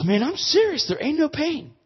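high, loud amazed insisting; he can't believe the pain is gone; excited insistence. Retold or imitated. Kid insists to preacher his knee is healed, high and amazed.

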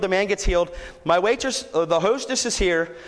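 usual speaking voice

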